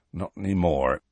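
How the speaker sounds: low, resigned rustic voice; sighing. A narrator's reading. Hagrid regrets losing the centaurs' goodwill, sighing. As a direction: let it sink with regret. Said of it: "He sighed deeply".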